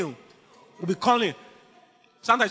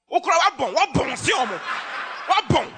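excited preacher shout; 9 semitones higher than usual. Real, not acted. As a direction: call it out over the crowd. Exclaiming loudly to congregation.

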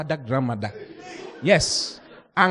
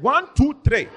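counting shout; 11 semitones higher, 5 dB louder, slower than usual. Retold or imitated. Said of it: "I said".

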